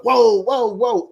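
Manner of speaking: high, loud whoa whoa whoa; hammed-up alarmed whoa; mock alarm. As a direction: pull back in mock shock. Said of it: "He was like".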